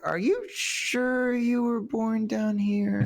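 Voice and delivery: high, drawn-out doubting question; skeptical mom. Retold or imitated. Girlfriend's mom questions his Southern roots, mock skeptical. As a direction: imitate a disbelieving mom, stretching the words. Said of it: "her mom was like".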